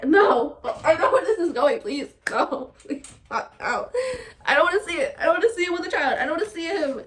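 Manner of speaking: loud, high pleading no with laughter; mock anguished protest. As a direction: beg the show to stop, laughing. Recalling dreaded TV scene.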